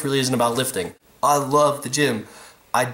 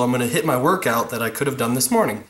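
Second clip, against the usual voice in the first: normal talking voice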